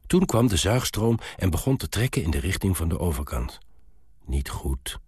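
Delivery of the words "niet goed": low, whispered mumble; muttering dwarf. A narrator's reading. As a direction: mutter it softly to yourself. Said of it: "mompelde de dwerg". (zacht mompelend)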